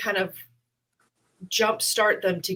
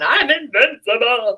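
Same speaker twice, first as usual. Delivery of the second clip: loud, higher boast of invincibility; cocky triumph; 4 semitones higher, 8 dB louder than usual. Retold or imitated. Comic walking offstage after a killer set, cocky boast. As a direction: strut it like you own the room. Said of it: "June, walking off going".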